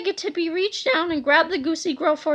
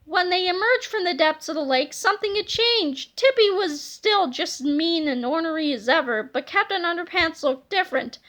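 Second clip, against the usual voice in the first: ordinary delivery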